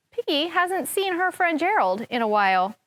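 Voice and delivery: normal talking voice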